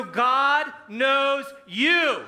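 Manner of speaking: shouted out slowly, drawn long; big rousing shout; loud call. Shown as a demonstration. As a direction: wake the room up with it. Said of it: "I still wanna wake you up a little bit".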